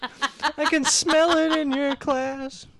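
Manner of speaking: squeaky goofy dub voice; high slow silly voice. Acted. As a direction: falsetto creep voice, slow. Dubbing video, silly voice.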